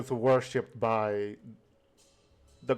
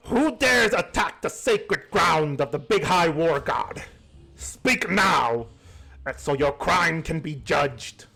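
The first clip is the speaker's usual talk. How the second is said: booming, raised shaman challenge, higher and louder; goblin shaman; 4 semitones higher, 3 dB louder than usual. Acted. Shaman confronts the intruders, booming and raised. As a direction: demand who trespasses on sacred ground. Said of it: "the shaman walks up and goes".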